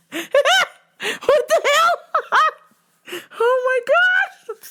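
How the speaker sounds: high squeals of laughter, slowed right down; cracking up in disbelief; shrieking with laughter at the absurd call; laughing. Real, not acted. Reacting to the caller's story.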